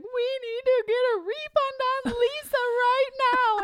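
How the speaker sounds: put-on high, loud wailing little-boy voice; imitating crying child. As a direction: wail it like an upset kid demanding a refund. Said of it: "he's crying".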